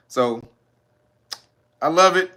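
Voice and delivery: ordinary talk, nothing marked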